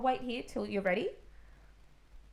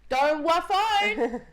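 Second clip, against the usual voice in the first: put-on high, slow toddler babble; little kid's garbled plea, higher and louder; 9 semitones higher, 6 dB louder, slower than usual. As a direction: mimic a three-year-old's mangled words. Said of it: "He goes".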